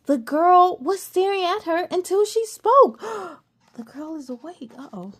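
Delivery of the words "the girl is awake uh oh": gasp, then much softer worry; scared whisper of being caught; alarmed hush. Acted. Cassie seeing the girl awake, alarmed hush.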